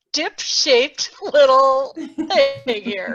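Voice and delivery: laughing through the words, drawn out slowly; amused, slow laughing aside. Real, not acted. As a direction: giggle while describing the shape. Laughing at her own diagram.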